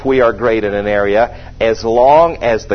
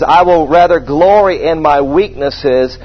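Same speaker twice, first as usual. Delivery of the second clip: preaching at full force; 5 semitones higher, slower than usual. Acted. Preaching rise on scripture.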